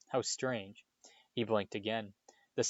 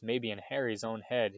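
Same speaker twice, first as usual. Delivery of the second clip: usual speaking voice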